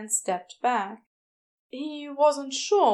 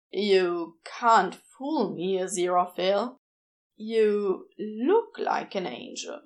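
a hint of a low, wary demon; lightly voiced Crowley; 3 semitones lower than usual. A narrator's reading.

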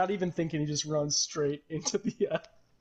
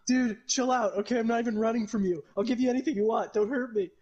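higher, louder, hurried begging; panicked pleading; 6 semitones higher, 4 dB louder than usual. Acted. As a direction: plead for your life as a cornered man. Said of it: "And he's like".

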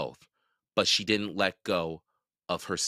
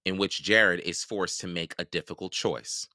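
ordinary delivery, nothing marked

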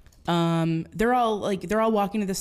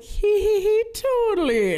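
high, slow giggling; giggly high reply; 5 dB louder, slower than usual. Retold or imitated. Girl giggling back at the boy. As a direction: giggle it out coyly.

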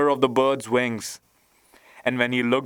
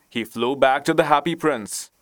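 usual speaking voice

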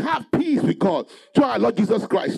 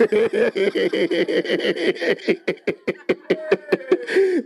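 long, pulsing laugh; deliberate, sustained laughter; rejoicing laugh; 3 semitones lower than usual. Shown as a demonstration. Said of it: "So I first rejoice".

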